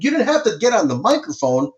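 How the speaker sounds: high, heated complaint; exasperated scolding of the floor guy; indignant retort. Retold or imitated. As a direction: pitch up in outraged disbelief. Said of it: "I'm like".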